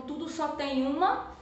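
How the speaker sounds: usual speaking voice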